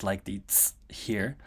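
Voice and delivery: ordinary talk, nothing marked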